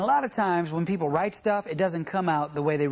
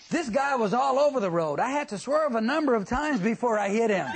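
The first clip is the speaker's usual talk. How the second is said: high, amused reading of a driver's excuse; 1 mock claimant; 6 semitones higher, 3 dB louder than usual. Instructor reading a claim form aloud as the self-righteous driver. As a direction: read the excuse like the driver means it.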